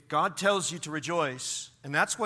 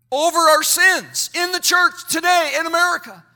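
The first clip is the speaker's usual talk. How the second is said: raised, forceful pulpit voice; impassioned preaching; 6 semitones higher, 7 dB louder than usual. Real, not acted. Preacher urging churches to weep over sins, impassioned. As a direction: press it out with full conviction.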